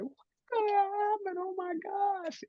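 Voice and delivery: high, far panicked squeal. Retold or imitated. Recalling her fear before coming out, panicked. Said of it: "I was just going".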